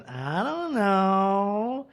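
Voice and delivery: slow, drawn-out mumble of "I don't know"; a sulky son stalling, very slow; mumbled I don't know. Retold or imitated. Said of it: "And Robert Junior goes".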